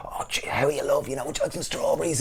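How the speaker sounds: soft, sweet-talking imitation; mock smitten lads. Market lads wooing the visiting girls, mock-tender.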